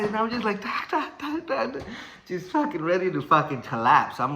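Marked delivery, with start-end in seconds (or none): 0.6-1.8 high, percussive "da da da"; imitated jab noises. Storyteller sounds out his jabs in round two, high rapid-fire. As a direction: punch out each syllable up high.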